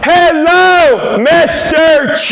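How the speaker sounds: shouted, high and slow, overly enunciated; mother-in-law greeting Korean dad as if he's deaf and foreign, shouting; loud slow hello. Retold or imitated. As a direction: yell it slowly like he can't understand. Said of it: "she looks at my dad and goes".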